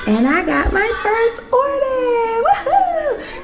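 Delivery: high, laughing cheer of joy; excited. Real, not acted. Seller celebrates her first order, high laughing cheer. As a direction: squeal with happy surprise. First sale notification arrives.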